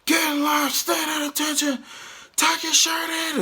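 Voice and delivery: high, loud barked orders imitating a drill instructor; drill instructor yelling. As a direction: yell the orders like an instructor at new recruits. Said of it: "They're just yelling and screaming".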